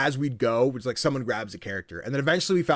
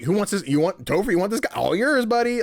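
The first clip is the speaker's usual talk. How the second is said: acted casting offer; 7 semitones higher, 3 dB louder than usual. Acting out handing out characters.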